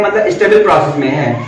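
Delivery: ordinary talk, nothing marked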